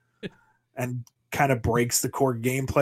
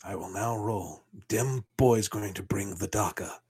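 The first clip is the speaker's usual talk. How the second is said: low, flat orc voice, no emotion; an orc reading rules in monotone; deadpan orc voice; 4 semitones lower, 4 dB quieter than usual. Acted. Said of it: "he speaks like the orcs, but still in that deadpan voice".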